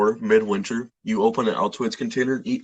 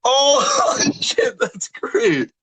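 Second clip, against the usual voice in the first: laughing shout; 14 semitones higher, 4 dB louder, much slower than usual. Real, not acted. Delighted by big subscriber count.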